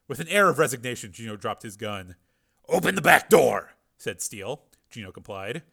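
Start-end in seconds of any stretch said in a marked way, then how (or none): 2.7-3.7 commanding. A narrator's reading. Hero orders.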